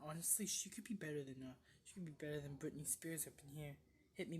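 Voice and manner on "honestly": ordinary talk, nothing marked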